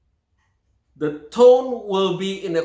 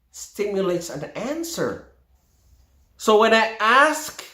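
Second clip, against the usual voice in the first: ordinary delivery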